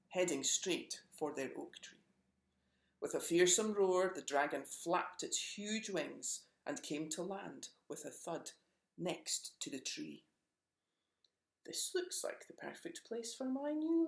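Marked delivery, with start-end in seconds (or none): 3.0-4.1 building. A narrator's reading. Narration swells for the roar. 11.6-14.1 high, pleased dragon claiming the tree; smug monster eyeing its new home. Acted. Dragon tells the animals the oak will be his lair, smug. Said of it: "said the dragon".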